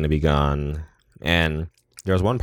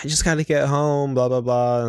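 much higher, frantic mock voice; 10 semitones higher than usual. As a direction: squeal it out like she's fleeing. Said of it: "And she's like".

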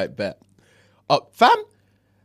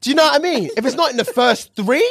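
excited; 10 semitones higher than usual. Real, not acted. Excited high-pitched insistence.

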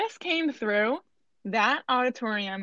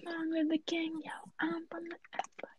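breathy whisper of a song lyric; 6 semitones higher, 13 dB quieter than usual. Pretend. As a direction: whisper it like a secret aside. Quoting lyrics in a hushed aside.

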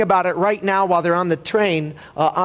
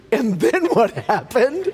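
acted dread; 6 semitones higher, slower than usual. Parents dreading son's answer.

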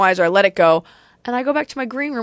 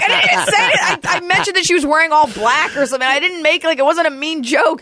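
higher-pitched, flustered self-defence; defensive protest; 8 semitones higher than usual. Retold or imitated. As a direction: protest your innocence in a raised pitch. Protesting over imitated crying.

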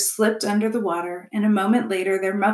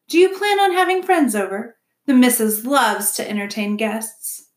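raised beaver voice, curious; chatty beaver; 3 semitones higher, 4 dB louder than usual. Acted. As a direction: ask sociably as a beaver husband. Mister Beaver quizzes robot.

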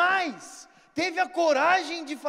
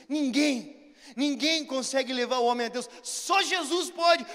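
preaching; 5 dB louder, slower than usual. Acted. Preacher's testimony turns to proclamation.

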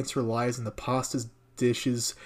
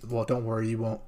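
ordinary talk

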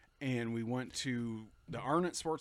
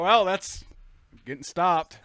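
louder, higher wry resignation; loud resigned quip; 5 semitones higher, 9 dB louder, slower than usual. Retold or imitated. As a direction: say it like it's over. Resigned at being pulled over.

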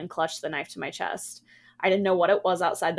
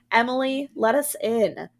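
louder, higher, insistent demand; narrated menace, pushed louder and higher; menacing demand; 5 semitones higher, 4 dB louder than usual. A narrator's reading. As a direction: demand to be let in, raised voice. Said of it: "Annie's voice became low and guttural".

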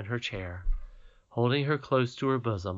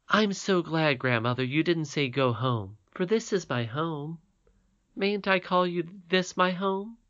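put-on lightly higher girl's voice; a hint of a happy child; glad little princess; 6 semitones higher than usual. Irene voiced by the reader.

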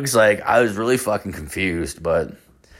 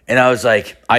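usual speaking voice

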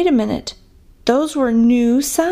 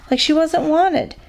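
ordinary delivery, nothing marked

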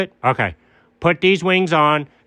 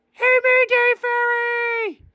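calling out; slower than usual. Acted. Calling out to the fairy.

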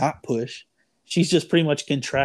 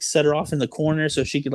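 ordinary delivery

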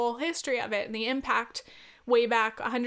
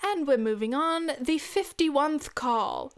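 higher, mock-British caller announcement; bingo caller impression; 3 semitones higher, slower than usual. Caller moving on, mock British announcer voice. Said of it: "at one point she was like".

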